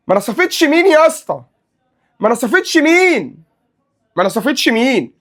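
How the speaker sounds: high, loud indignant shout, stretched out slowly; mock outrage. Ranting back at a critic about Messi, high indignant cry. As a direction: act appalled, hammy and high.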